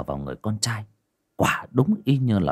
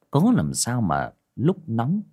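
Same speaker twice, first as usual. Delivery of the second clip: ordinary delivery, nothing marked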